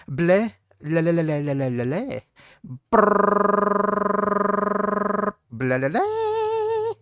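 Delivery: loud tongue-flapping bleh-le-le with a trilled brrr; silly rolling-tongue gibberish greeting; made-up pineapple language. Pretend. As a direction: babble a nonsense greeting, ending high and drawn out. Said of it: "greet everybody in the language of the pineapple people".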